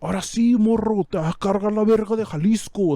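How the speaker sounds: imitating a cheesy villain from Jalisco; mock Jalisco villain. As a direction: ham up a movie narco boss. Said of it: "from Jalisco".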